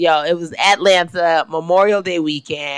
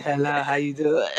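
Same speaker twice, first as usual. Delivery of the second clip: husky sexy greeting; 3 semitones lower, 5 dB quieter than usual. Pretend.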